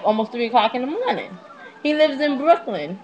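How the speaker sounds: ordinary talk, nothing marked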